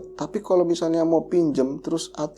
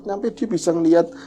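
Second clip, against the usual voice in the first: ordinary talk, nothing marked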